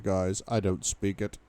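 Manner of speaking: ordinary delivery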